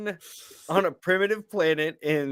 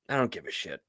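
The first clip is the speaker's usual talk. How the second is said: much lower, softer, bored imitation; deadpan character quote; 11 semitones lower, 10 dB quieter, faster than usual. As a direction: drop it low and couldn't care less. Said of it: "he's like".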